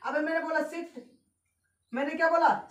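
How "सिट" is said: "सिट" with a short i, cut off quickly; short sound. Shown as a demonstration. Teacher showing the short sound, "सिट" said short. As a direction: keep the vowel in "सिट" brief. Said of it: "short बोला".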